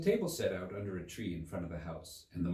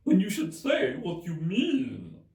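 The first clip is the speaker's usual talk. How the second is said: high, loud hare voice; March Hare voice; 10 semitones higher, 8 dB louder, slower than usual. Acted. March Hare questioning Alice, high and loud.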